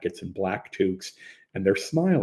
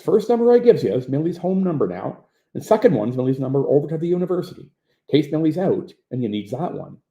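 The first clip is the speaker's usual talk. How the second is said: quick, friendly Newfoundland lilt, a bit higher; Newfoundland accent; 4 semitones higher, 4 dB louder than usual. Retold or imitated. Operator giving Millie's numbers to the caller, light Newfoundland accent. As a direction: rattle off the numbers like a Newfoundland local.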